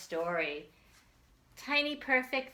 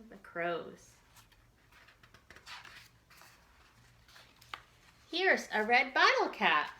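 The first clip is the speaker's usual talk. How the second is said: put-on reading to children; 3 semitones higher than usual. Teacher reads picture book to children.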